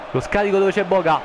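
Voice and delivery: high, loud shouted commentary; excited goal call. Acted. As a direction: shout the strike as it goes in.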